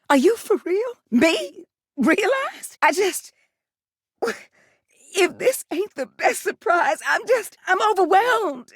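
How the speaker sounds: high, breathless disbelief at the news; gasping, can't-believe-it delight; overwhelmed surprise. Acted. Water heater hears she'll be made real, overwhelmed.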